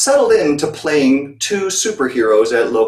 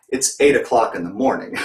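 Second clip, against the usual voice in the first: ordinary delivery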